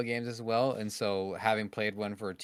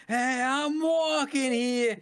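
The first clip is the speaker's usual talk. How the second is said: slow, much higher, mock New York "Hey, I'm walking here"; guy in a New York TikTok, hammed-up New York accent; New York tough guy; 5 dB louder, much slower than usual. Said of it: "He's like".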